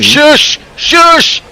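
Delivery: sharp high shush, mock exasperation; urgent shushing. Real, not acted. Hushing a jinxing colleague.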